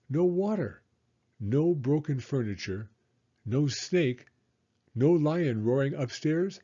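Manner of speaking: plain reading voice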